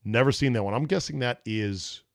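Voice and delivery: ordinary delivery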